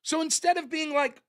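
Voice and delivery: usual speaking voice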